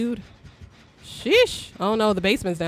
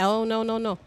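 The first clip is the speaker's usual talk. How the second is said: ordinary talk, nothing marked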